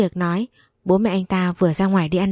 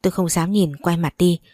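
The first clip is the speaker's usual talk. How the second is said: ordinary delivery, nothing marked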